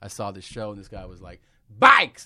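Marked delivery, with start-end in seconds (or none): 1.8-2.2 sudden high, explosive mock yell; imitated yell. Copying a guy from a show, sudden yell. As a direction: blurt it out at full volume, imitating him. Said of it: "Like, he yelled it".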